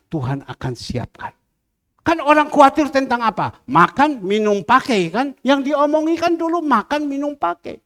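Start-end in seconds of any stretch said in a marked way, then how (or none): 2.0-7.8 loud, high-pitched exhortation; forceful preaching. Real, not acted. Preacher urging trust in God over people, forceful. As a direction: drive the point home to the congregation.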